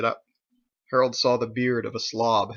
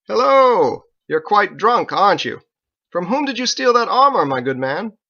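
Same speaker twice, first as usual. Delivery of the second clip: loud, higher, hearty and mocking; booming cheerful greeting; 9 semitones higher, 9 dB louder than usual. Knight looming over the drunk, booming cheerful hello. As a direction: hail him like a jolly lord. Harold hailing the drunk loudly.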